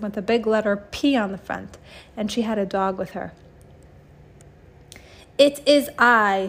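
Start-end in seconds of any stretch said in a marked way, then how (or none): 5.4-6.5 slow, loud, dramatic proclamation; grand announcement. Acted. Supervillain entering the class, slow and grand. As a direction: announce yourself like a villain. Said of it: "she announced".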